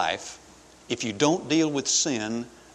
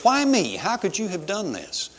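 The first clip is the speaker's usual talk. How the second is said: high, aggrieved mock protest; mad at God; 7 semitones higher than usual. Cain complaining to God, mock-aggrieved and high. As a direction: wail it as a complaint, falling away. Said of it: "He's mad at God because he says".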